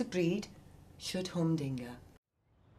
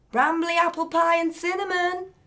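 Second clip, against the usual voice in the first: louder, much higher, announcing the label; reading out loud; 11 semitones higher, 8 dB louder than usual. A narrator's reading. Nicky reading Ollie's bin wrappers aloud, loud and high. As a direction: call out the label like an accusation. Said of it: "Nicky read out loud".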